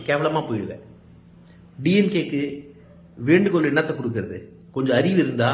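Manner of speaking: normal talking voice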